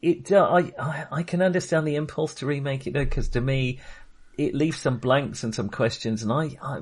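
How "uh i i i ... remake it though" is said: ordinary talk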